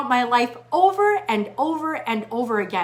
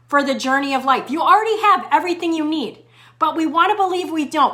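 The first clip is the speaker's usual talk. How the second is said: ordinary delivery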